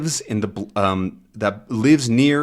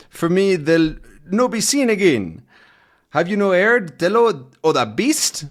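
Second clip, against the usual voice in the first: put-on an old Scottish man, higher and rolling; thick Scottish accent; 6 semitones higher than usual. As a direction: lay on a thick Scots brogue for the old pub man. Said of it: "some thick accented English".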